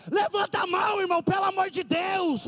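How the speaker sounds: high, loud shouted sermon; fiery preaching. Acted. Preacher shouting to the congregation, fiery high preaching. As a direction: preach it full force from the pulpit.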